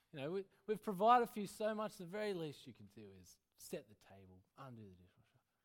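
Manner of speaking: scolding, fading to mutter; scolding parent. Acted. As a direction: lecture the ungrateful kid. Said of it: "the mind frame of my past".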